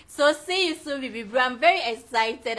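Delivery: excited. Real, not acted. Excited farewell to a guest.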